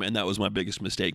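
ordinary talk

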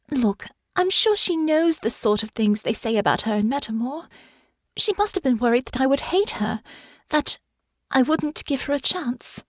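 Honor's voice. Acted. Honor explains her mother's fear, earnest. Honor's dialogue in narrated fiction.

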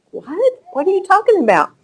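high, fast, incredulous "What?"; mother hearing something absurd from her son, surprised; startled mom. Retold or imitated. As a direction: jump up in pitch like a mother caught off guard. Mom shocked by crying son.

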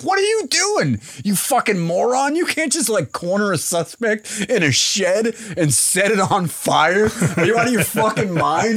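high, exasperated cop tirade; yelling cop. Retold or imitated. Host voicing the cop scolding his partner, high exasperation. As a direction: berate him like a fed-up cop. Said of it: "He's like".

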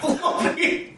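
very high laughing squeak; high squeaky voice. Pretend. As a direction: squeak the name. High mock name.

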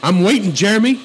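loud, high, pushy hustler demanding payment; pushy pimp voice. Pretend. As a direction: shout it like a greedy pimp.

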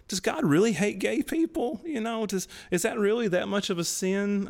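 high-pitched mock pondering; wondering doubts. College student reasoning with himself about sin, high and doubtful. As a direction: raise the voice high, questioning as if testing an idea. Voicing his younger self's doubts.

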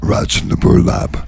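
half-whispered album name; odd whispery voice. Shown as a demonstration. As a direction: say it in a hushed rasp. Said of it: "Does it have to sound like that".

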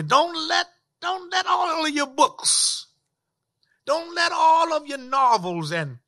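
high, insistent preaching with repeated warnings; urgent preaching exhortation. Real, not acted.

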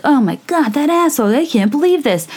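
high, mock-shocked gasp of outrage; mock outraged reader. Reader reacting to a rude story, mock outraged. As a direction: ham up a scandalized reader's reaction. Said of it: "it's like".

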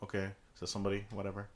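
low, soft, shrugging it off; retold indifference, quietly tossed away; dismissive mumble. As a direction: toss it off under your breath. Retells his own indifferent reaction.

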